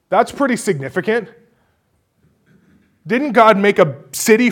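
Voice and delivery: high, loud, incredulous preaching. Acted. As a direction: preach it with rising disbelief. Preaching at full force.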